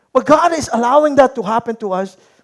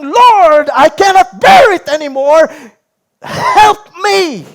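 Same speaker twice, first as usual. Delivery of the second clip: higher, louder, near-shouted cry to God; desperate crying plea; 6 semitones higher, 6 dB louder, much slower than usual. Pretend. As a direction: cry out like you can't bear any more. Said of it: "and you are saying". (umiiyak na pagsusumamo sa Diyos)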